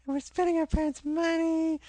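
very high, loud, airy mock-youth voice; sing-song parody of spoiled students; mocking carefree college kid. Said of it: "he and I go".

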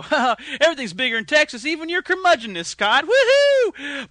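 hearty backslapping state voice cheering; cheerful Texas as a person. Pretend. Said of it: "and go".

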